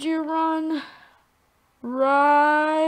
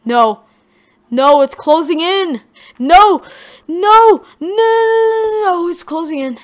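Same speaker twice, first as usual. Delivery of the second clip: panicked; 6 semitones higher, 11 dB louder than usual. Real, not acted. Storm closing in, panicking.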